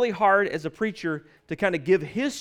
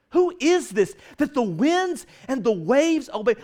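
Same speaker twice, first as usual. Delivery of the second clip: higher and louder, slow, ringing with wonder; preacher voicing the disciples' wonder, raised and slow; amazed proclamation; 7 semitones higher, 5 dB louder than usual. Disciples' awed question, proclaimed.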